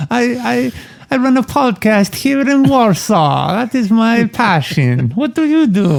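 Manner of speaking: put-on high, slow Polish accent. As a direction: introduce yourself in a Polish accent. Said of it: "you do a Polish accent".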